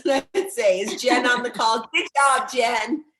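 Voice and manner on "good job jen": louder, punchy good job; proud cheer. Real, not acted. Mentor cheering the Mexico trip winners, louder. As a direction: cheer it out to the team. Cheering a specific team member.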